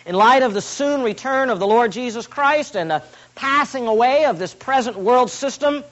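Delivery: ordinary talk, nothing marked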